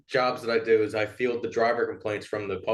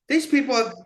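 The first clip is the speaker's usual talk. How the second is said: higher mock rant, half laughing; irate caller, laughing through; 10 semitones higher than usual. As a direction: golfer ranting about the driver, mock fury. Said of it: "he was like".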